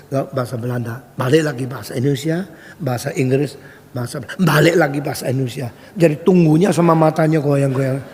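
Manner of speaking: normal talking voice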